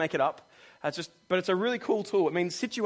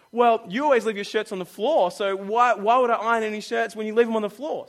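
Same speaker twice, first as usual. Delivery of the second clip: mock retort; 4 semitones higher, 8 dB louder than usual. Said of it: "Sarah will be like".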